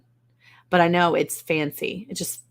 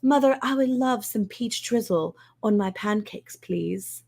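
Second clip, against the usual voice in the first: put-on higher, prim fancy-kid voice; posh child request; 3 semitones higher than usual. Kids asking mother for peach drizzle, prim posh child voice. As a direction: put on airs like a well-bred child. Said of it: "They're gonna be like".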